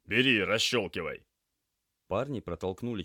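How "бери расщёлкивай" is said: brisk, loud command; urgent order. Acted. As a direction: order a crewmate into action. Urgent order during patient transfer.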